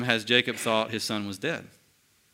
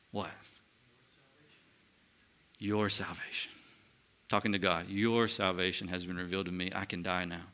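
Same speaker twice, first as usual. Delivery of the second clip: soft reverent reading; 4 semitones lower, 7 dB quieter than usual. Acted. Simeon to God, hushed and reverent. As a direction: hush it, an old man giving thanks.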